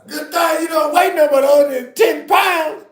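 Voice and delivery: loud high ranting, half laughing; mock angry rant. Comedian imagining telling her off, mock angry. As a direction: let her have it, hammed up. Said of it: "I wanted to say".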